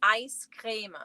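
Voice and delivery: Ice creme said with a long ee, like creem; regional pronunciation. Shown as a demonstration. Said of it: "We pronounce it ice creme".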